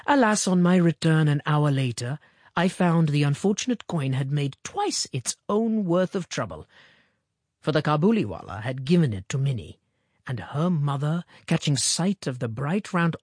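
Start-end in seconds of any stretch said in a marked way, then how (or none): none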